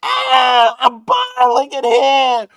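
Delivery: high, very loud drawn-out scream; screamed from inside. Acted. Burning tank crying out from inside a metal can, screamed. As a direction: scream it out like you're being cooked. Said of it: "it is screamed like inside".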